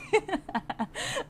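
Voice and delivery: high-pitched amused laugh. Real, not acted. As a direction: laugh at the burn. Laughing at rival's outfit jab.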